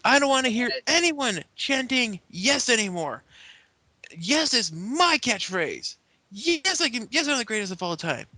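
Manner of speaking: high-pitched, hammed-up indignation; mock outraged tirade; petulant rant. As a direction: claim your catchphrase like a wrestling heel. Acts Daniel Bryan's rant about his catchphrase.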